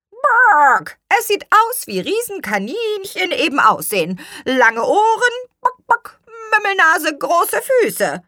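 high, loud, squawky hen; exasperated chicken squawk; clucking hen. Acted. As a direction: rattle off features between clucks.

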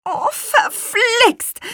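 high, drawn-out exasperated curse; scolding curse. A narrator's reading. Witch curses the cracked window pane, high drawn-out scolding. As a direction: stretch the oath slowly up high. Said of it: "schimpfte die Apfelhexe".

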